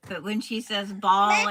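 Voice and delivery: normal talking voice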